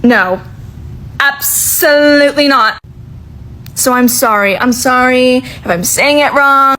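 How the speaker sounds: ordinary talk, nothing marked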